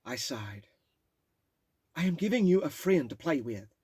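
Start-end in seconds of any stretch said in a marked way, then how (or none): 1.9-3.8 loud, higher mom; mother's voice. Retold or imitated. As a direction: announce it like a drunk mom.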